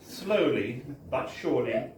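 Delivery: ordinary delivery, nothing marked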